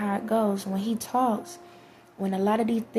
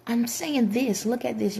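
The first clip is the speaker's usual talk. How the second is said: a lightly voiced, higher insistent plea; pleading explainer; 4 semitones higher than usual. Him urging doubters to see, lightly voiced. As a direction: lightly act him pointing, "here, look". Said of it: "And he's like".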